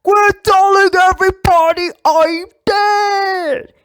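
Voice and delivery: high, loud, slow cranky shout; old Steve yelling. Acted. Old Steve Rogers shouting from the back, high and furious. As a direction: bellow it from the back like an indignant old man. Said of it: "elderly Steve Rogers in the back going".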